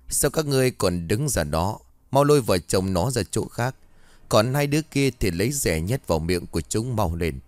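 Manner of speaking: ordinary delivery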